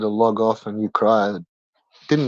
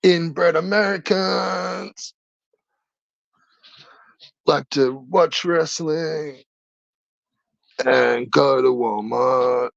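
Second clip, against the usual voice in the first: slow, drawn-out mock voice, pitched up at first; put-on voice; 4 semitones higher, slower than usual. Streamer mocks chat in a slow put-on voice. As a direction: ham up a slow mocking drawl. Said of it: "How was that? Was that beautiful?".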